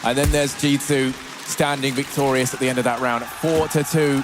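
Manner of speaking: building live play-by-play; rising excitement, calling the action; esports caster. Acted.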